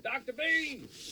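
faint distant call of Doctor Beans!; calling from far off. Acted. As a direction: shout his name from down the street. Calling from down the street.

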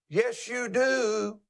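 high, slow crowing rooster; hammed-up rooster call. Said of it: "The rooster said".

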